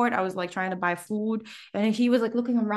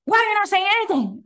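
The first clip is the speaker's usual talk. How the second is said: high, fast, louder mock scold; scolding mom; 10 semitones higher, 4 dB louder, faster than usual. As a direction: nag the kid for staying silent. Mom scolding the silent kid.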